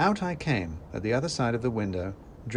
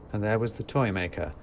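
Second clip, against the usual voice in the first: ordinary delivery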